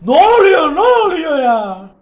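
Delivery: high, loud, then slow drawn-out what; shocked disbelief. Real, not acted. Gamer dying suddenly, loud stunned disbelief. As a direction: cry out in confused shock.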